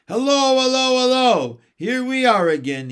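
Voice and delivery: high, loud joyful shriek; joyful scream. A narrator's reading. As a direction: scream it out with delight. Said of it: "he screamed joyfully".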